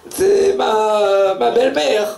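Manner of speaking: high-pitched hammed-up indignation; mock furious disciple. Translator voicing the disciple, hammed-up high fury. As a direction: squeal the anger, comically. Disciple blames mother-in-law.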